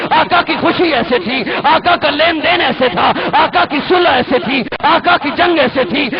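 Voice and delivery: full-force pulpit challenge; fiery preaching. Acted. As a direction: thunder it at the congregation.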